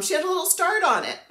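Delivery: breaking into a laugh; quick, laughing delivery; amused. Real, not acted. Amused at friend's small start.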